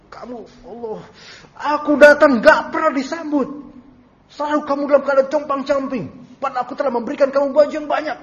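high, loud scolding complaint; controlled anger. Acted. Husband angrily complains his wife never greets him, loud scolding. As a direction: scold her, angry but still in control. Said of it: "Dia marah, marah gitu, tapi masih ada kesadaran".

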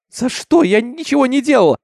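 very high, loud frightened cry; desperate protest. A narrator's reading. Seized Minty protests she did nothing, desperate high cry. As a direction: wail your innocence at high pitch.

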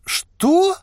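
very high startled question; high-pitched shock; astonished. A narrator's reading. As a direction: squeak it in disbelief. Merchant astonished by the news.

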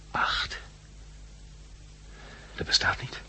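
unvoiced groggy whisper; sleepy whisper. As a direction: whisper it, half asleep in disbelief. Waking man mutters in disbelief.

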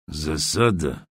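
low, soft words through shut lips; through closed lips. Acted. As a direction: ask without opening your mouth. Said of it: "не разжимая губ". (сквозь сжатые губы)

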